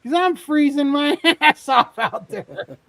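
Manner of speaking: high, loud mock wail breaking into laughter; exasperated punchline. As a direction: crack up while acting the freezing cub. Cub's punchline, teller cracking up.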